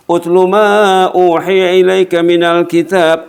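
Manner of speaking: slow chanted Arabic verse; Quran recitation. Acted. Preacher chanting Quran to congregation, slow. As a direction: intone the verse slowly.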